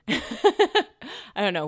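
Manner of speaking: slow words through laughter; laughing. Real, not acted. Laughing at borough correction.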